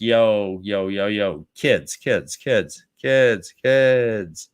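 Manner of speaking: low, laid-back warrior voice; low Lan voice. Retold or imitated. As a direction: drawl it like a bored mentor. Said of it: "Lan's like".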